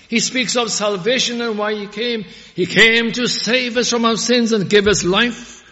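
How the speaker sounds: loud, high, ringing sermon voice; full-force pulpit preaching; fiery preaching. Acted. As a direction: proclaim it to the whole congregation.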